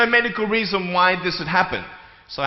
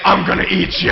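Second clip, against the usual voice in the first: lightly voiced playful monster, a bit louder; mock monster threat; 4 dB louder than usual. He chases the girl, playfully threatening to eat her. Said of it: "running after her saying".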